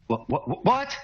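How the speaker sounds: rising, the last what high and loud; startled awake. Acted. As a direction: jolt awake, spluttering. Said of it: "He awakes with a start".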